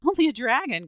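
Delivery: loud, high, incredulous outburst; indignant cry. A narrator's reading. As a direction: throw it back as an outraged question. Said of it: "cried his father".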